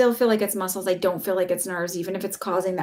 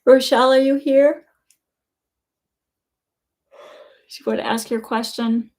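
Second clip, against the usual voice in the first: yawning while talking; 4 semitones higher, 6 dB louder than usual. Real, not acted. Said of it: "Sorry about yawning so much".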